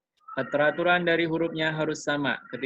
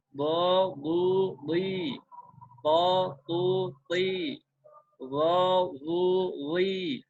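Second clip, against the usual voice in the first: recitation drill; 4 semitones higher, much slower than usual. Shown as a demonstration. Teacher reciting letter triplets to students, clear and even. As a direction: sound out each triplet clearly for the class.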